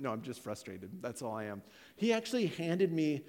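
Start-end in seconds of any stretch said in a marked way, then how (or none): 0.0-1.6 low, soft insistence; closed-off denial. Retold or imitated. Husband deflecting the probing counselor, closed-off denial. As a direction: close the subject quietly.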